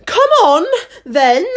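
very high, loud royal roar; shrieking order; roared command. A narrator's reading. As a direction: roar it out as a bossy queen. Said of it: "roared the queen".

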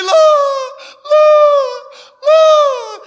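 high, loud hammed-up wail; mock wailing. Preacher mimicking tearful people at the altar, mock wailing. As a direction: imitate bawling in long cries to heaven. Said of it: "cry like a baby".

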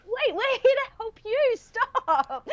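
high, startled protest; mock alarm at being helped; flustered protest. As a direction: caught off guard, waving them away. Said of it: "And I'm like".

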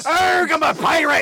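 high, drawn-out pirate cry; mock pirate yell. Comedian replaying the audition from her end, mock pirate yell. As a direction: stretch the pirate blurt up high. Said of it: "you fucking just yelled".

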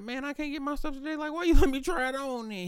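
squeaky put-upon customer; high whiny complaint. Retold or imitated. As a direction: whine it up high, mock outraged.

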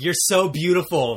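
mock-adoring fan, drawn out; gushing fan reply. Fan replying to a celebrity tweet, gushing slowly. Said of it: "it'll be like".

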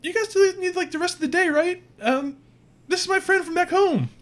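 high, chirpy alien voice; Kritka's voice. Acted. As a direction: pitch up, casual and happy. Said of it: "says, uh".

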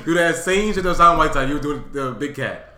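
loud, playful boss demanding toughness; mock-excited loud orders; hammed-up eager urging. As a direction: urge it on loudly, playing the boss. Still acting Pat Riley's demand.

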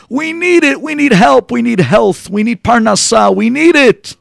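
high, loud, insistent demands; impassioned plea. Acted. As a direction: cry out to heaven, hammering each request. Congregation pleads loudly with God.

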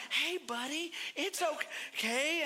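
put-on calm a scared kid down slowly; coach soothing.

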